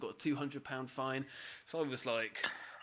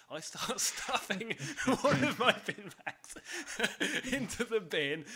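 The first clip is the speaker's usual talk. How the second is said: telling it through laughter, higher voice; laughing; 8 semitones higher than usual. Real, not acted. Teller recounting cramming a bag into a bin, laughing. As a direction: stuff the words in between laughs.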